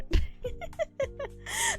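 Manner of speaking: high-pitched giggle. Real, not acted. Laughing after joking self-praise.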